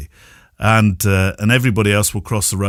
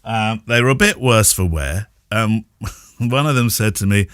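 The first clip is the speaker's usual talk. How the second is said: ordinary delivery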